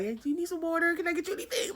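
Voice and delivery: high-pitched anxious fussing; profusely apologetic. Retold or imitated. Storyteller placating her scary roommate, high apologetic offer. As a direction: scramble to appease, voice way up. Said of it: "started profusely apologizing to my roommate".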